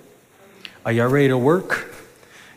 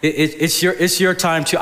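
ordinary talk, nothing marked

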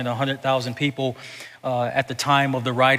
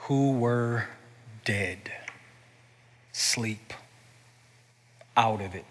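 hushed; 6 semitones lower, 10 dB quieter than usual. Real, not acted. Describing the spiritually dead.

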